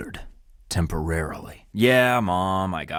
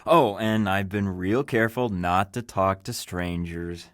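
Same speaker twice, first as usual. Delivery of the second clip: normal talking voice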